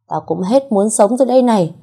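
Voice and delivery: high, loud desperate cry; desperate outburst. A narrator's reading. Thủy raising the knife at the mother, desperate loud cry. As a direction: burst out with nothing to lose.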